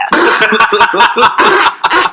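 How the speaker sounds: loud bubbling bong-hit impression. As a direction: imitate a long bong hit. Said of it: "Would you like to hear my impression of a bong hit?".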